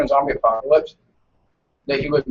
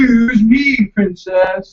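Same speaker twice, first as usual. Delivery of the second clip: raised, loud teasing retort; mock offence; 7 semitones higher, 5 dB louder, much slower than usual. Teasing a friend who shrugged off the question, mock-offended. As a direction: call it out with exaggerated indignation.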